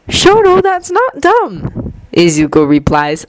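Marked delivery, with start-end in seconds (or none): 0.1-1.9 honest, gentle reassurance. A narrator's reading.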